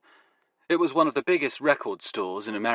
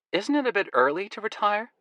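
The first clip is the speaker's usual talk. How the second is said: teasing American woman; 11 semitones higher than usual. Acted. Woman character voice.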